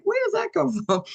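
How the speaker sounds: wife amazed by her husband's joyful story, mock wonder. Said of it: "the wife's looking at him like".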